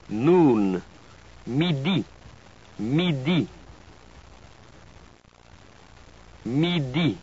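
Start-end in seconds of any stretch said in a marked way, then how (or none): 1.5-7.2 "Midi" with stress on the last syllable, both vowels pure i; French pronunciation. Shown as a demonstration. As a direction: model "Midi" with the final di stressed. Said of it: "the last syllable is always stressed".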